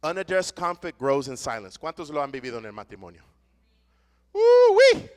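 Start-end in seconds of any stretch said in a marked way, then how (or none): 4.3-5.1 high, loud mock huff of a displeased spouse; spouse's annoyed grunt. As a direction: imitate the sound a sulking spouse makes. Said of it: "And you hear him".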